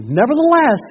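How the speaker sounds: booming pulpit reading of scripture; full-force preaching. Acted. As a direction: proclaim it to the back row.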